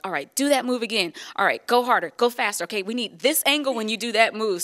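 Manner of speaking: loud imitated commands from behind the camera; cameraman calling out. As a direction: push the dancers like a director on set. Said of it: "The, the cameramen are like".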